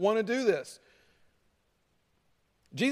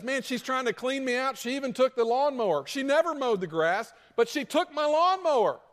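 higher, indignant complaining husband; aggrieved husband; 6 semitones higher than usual. Retold or imitated. Divorcing husband griping to his pastor, rising indignation. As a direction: whine about the lawnmower, rising. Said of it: "dude comes in and he says".

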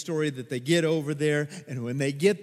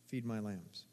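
Feed my lambs, repeated much softer and lower; hushed echo; 6 semitones lower, 11 dB quieter than usual. Shown as a demonstration. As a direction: let "Feed my lambs" sink in, barely spoken.

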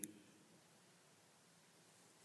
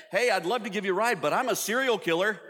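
much higher, breezy joke of a line; mock cheerful serial killer; 10 semitones higher than usual. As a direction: toss it off brightly as a gag. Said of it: "I might start using that".

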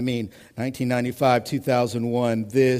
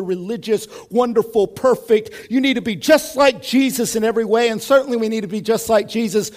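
raised, louder, insistent sermon voice; full-force preaching; 8 semitones higher, 7 dB louder than usual. Real, not acted. Preacher challenging demands to be perfect, full-force preaching. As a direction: build up and drive each phrase home.

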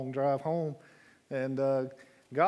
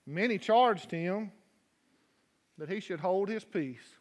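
preaching; 4 semitones higher than usual. Acted. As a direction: read scripture building with fervor. Preaching the scripture reading.

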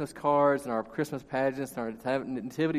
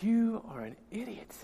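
imagined look; 6 semitones higher, 8 dB quieter than usual. Retold or imitated. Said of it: "she had this look of".

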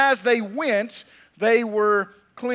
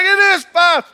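high, loud joyful confirmation; excited Bubba; 8 semitones higher, 10 dB louder than usual. Acted. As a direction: shout it back in delight. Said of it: "they were all excited about their healing".

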